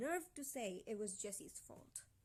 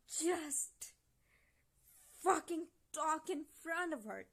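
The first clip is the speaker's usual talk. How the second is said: high, raised-voice outrage; frustrated outburst, pitched up; real exasperation; 5 semitones higher, 4 dB louder than usual. Real, not acted. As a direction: plead angrily in broken bursts. Furious at character's choices.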